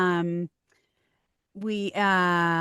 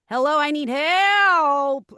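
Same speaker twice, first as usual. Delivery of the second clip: slow, higher, louder malfunctioning robot; broken robot; 6 semitones higher, 5 dB louder, much slower than usual. Shown as a demonstration. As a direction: stretch the plea out like a failing robot. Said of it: "There would sometimes be like".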